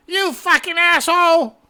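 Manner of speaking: put-on high furious elderly shout; old man yelling. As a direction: scream like an enraged old man. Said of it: "when a seventy-year-old gets pissed".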